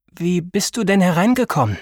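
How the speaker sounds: put-on calm old woman asking; not really angry. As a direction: ask without much anger. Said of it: "klang nicht einmal sonderlich wütend".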